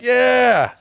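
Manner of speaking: loud, high, celebratory yell; mock cheer. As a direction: cheer like the drivers behind. Honking drivers cheering him on.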